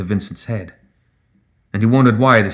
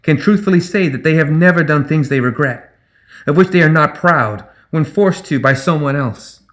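higher-pitched rhetorical question; high, clear challenge; 6 semitones higher than usual. A narrator's reading. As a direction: press the crowd to admit it. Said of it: "she echoed".

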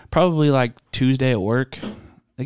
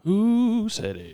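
mock game show echo; 12 semitones higher, much slower than usual. Echoing the game title.

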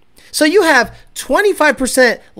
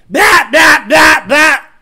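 loud, higher staccato bats; imitated engine bangs; 4 semitones higher, 7 dB louder, much slower than usual. Tuner mimicking a car's pops, loud imitated bangs. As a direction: bark out short mimicked bangs.

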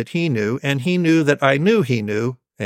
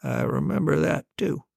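softer, higher voice for Dad; dad's quiet reply; 4 semitones higher, 6 dB quieter than usual. Acted. Dad answering his child's reminder, quiet reply. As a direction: acknowledge it softly, pitch lifted.

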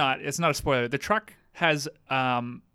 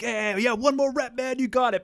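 higher, pumped-up gym encouragement; gym spotter; 7 semitones higher than usual. Retold or imitated. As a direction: hype up a lifting buddy. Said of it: "you see people like".

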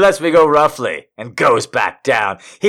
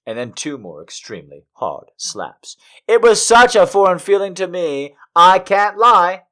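ordinary delivery, nothing marked

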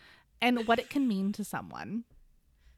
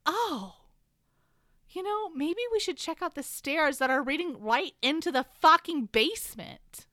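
sarcastic eureka; 7 semitones higher, 7 dB louder than usual. Retold or imitated. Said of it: "and he's like".

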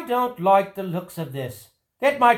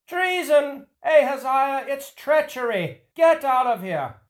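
panicked cry of treason; alarmed shout; 6 semitones higher than usual. Acted. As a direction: yell a warning in panic. King cries treachery, flees.

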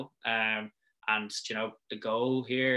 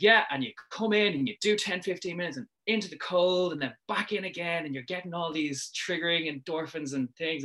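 higher eager pitch voice; sales pitch; 6 semitones higher than usual. Retold or imitated. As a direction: keep selling the sauna with gusto. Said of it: "in full sales pitch mode".

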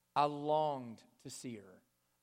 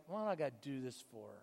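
grudging whine; 3 semitones lower, 7 dB quieter, faster than usual. Retold or imitated. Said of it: "kicking and screaming like".

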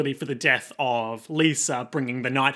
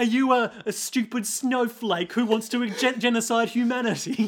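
mocking; 7 semitones higher than usual. Acted quarrel, high voice.

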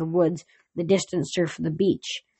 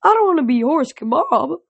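alarmed; 9 semitones higher, 4 dB louder than usual. Acted. Pegasus alarmed by sword.

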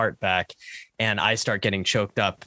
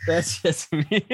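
laughing; 7 semitones higher, slower than usual. Acted. Mock hurt at co-host's joke.

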